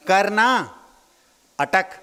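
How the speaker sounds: "करना" then a pause, then "अटक"; "करना अटक" said as two words with a gap; split into parts. Shown as a demonstration. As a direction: break it as "करना" and "अटक", pausing between. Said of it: "दूसरे तरीके से उसको और तोड़ेंगे".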